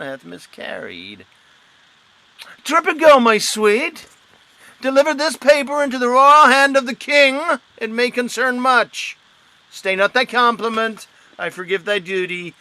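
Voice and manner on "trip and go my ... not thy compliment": higher, louder, fast dismissal; brisk command. Acted. Pedant sending the girl off with the letter, brisk and loud. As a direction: hurry her off briskly.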